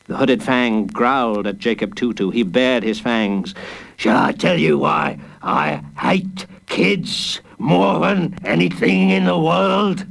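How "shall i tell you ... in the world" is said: menacing villain. A narrator's reading. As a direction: lean in and threaten. Villain speech.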